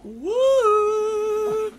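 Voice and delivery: loud high whoop; excited whoop. Real, not acted. Streamer whooping loudly for the stream. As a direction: let out a big high cheer.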